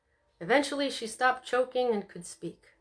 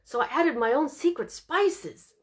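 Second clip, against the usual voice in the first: proud sing-song; 4 semitones higher, 4 dB louder than usual. A narrator's reading. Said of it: "finished proudly in a sing-song".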